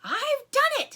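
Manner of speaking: high, loud triumphant yell; joyful yell. Acted. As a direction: shout it out in triumph. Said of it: "he yelled".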